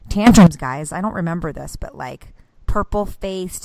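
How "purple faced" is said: ordinary talk, nothing marked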